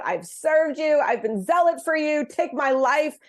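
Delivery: Elijah crying out. Retold or imitated. Elijah crying to God to take his life, high pleading. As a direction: give a pleading outcry from Elijah. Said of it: "Elijah kind of crying out".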